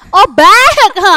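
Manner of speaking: retold panic at the till; panicked confusion. Tourist at the till, retold panicked confusion. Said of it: "Hampir kita mau nangis".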